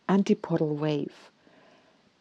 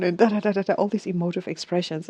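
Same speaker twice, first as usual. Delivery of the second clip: ordinary delivery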